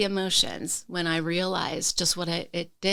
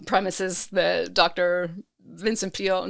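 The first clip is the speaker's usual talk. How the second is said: ordinary talk, nothing marked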